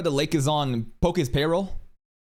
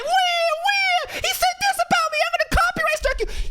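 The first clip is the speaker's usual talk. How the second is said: very high, squeaky mock whining; hammed-up baby wail threatening a strike; mock crybaby squeal. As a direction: squeal it like a baby crying foul. Mocking crybaby streamer.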